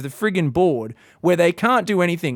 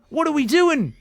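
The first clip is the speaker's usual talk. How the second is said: ordinary talk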